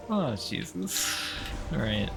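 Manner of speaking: ordinary talk, nothing marked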